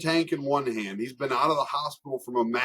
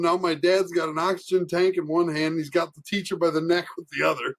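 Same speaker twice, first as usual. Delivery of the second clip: ordinary delivery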